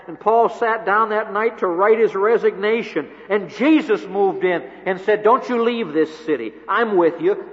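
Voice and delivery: loud, rising pulpit delivery, building to Jesus' words; full-force preaching. Acted. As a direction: preach it out with fire to the congregation.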